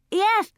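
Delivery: high cry of "Yes" with a clumsy tongue; burned-tongue cry. A narrator's reading. Coyote confirming Granny's translation, high cry "Yes". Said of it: "cried Coyote".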